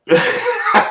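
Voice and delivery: sudden high-pitched laugh. Real, not acted. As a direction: burst out laughing, high and loud. Laughs at a viewer's comment.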